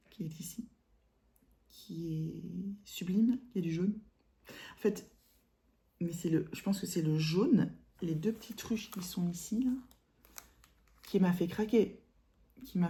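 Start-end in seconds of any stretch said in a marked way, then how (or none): 0.1-3.4 soft, slow whisper of admiration; whispered aside. Real, not acted. 8.0-9.7 softer whisper pointing things out; whispered aside. Real, not acted. Whispered aside while showing.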